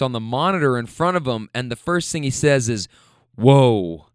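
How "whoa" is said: lower, louder wow; impressed whoa. Retold or imitated. Rider admiring the album, impressed. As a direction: struck by the music.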